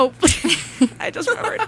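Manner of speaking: high, surprised i just remembered; light up with sudden recall; sudden realisation. Real, not acted.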